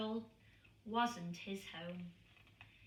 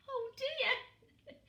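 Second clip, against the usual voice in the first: mild amused mum voice; 4 dB louder than usual. A narrator's reading. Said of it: "said Missus Pilcher Brown".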